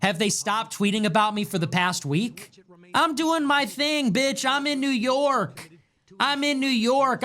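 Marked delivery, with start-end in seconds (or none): 2.9-7.2 cocky brag. Pretend. Boastful sing-song brag.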